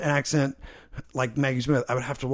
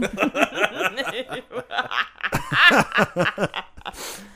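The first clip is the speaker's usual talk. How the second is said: helpless laughter; laughing fit; 5 semitones higher than usual. Real, not acted. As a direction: burst into a long laugh. Laughing at own bit.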